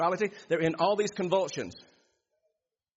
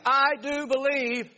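higher, louder, slow cry of faith; exclaiming father; 7 semitones higher, 4 dB louder, slower than usual. Acted. The father exclaiming his belief to Jesus, pleading. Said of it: "the boy's father exclaimed".

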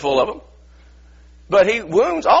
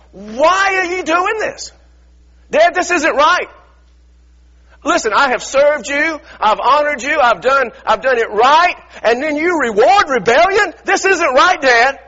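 raised, slowed pleading complaint to a father; indignant protest; 9 semitones higher, 5 dB louder than usual. Acted. Older son confronts his father over the party, indignant and raised. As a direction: push back at Dad, hurt and affronted.

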